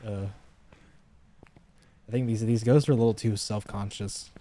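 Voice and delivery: ordinary delivery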